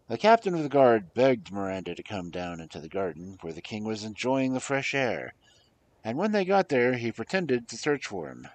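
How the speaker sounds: usual speaking voice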